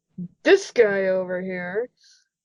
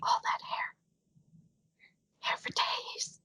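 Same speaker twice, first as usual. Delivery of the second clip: hushed, voiceless aside; whisper; 13 dB quieter, slower than usual. Real, not acted. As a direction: whisper it under your breath, drawing out the end. Murmured aside about the mane.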